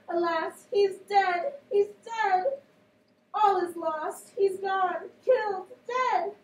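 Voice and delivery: high, loud dramatic lament; hammed-up wailing nurse. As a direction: wail it like a grieving nurse. Teacher reads nurse lamenting.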